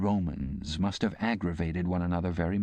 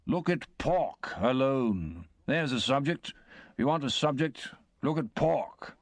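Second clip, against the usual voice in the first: higher, slow, self-important sermonizing; pompous uncle; 7 semitones higher, slower than usual. Acted. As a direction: lean into every word like a pompous guest. Pumblechook lecturing about pork.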